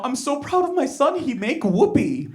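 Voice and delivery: lightly voiced proud Korean mother; proud mom. Mom boasts about her son's performance, mock-proud voice. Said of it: "And my mom".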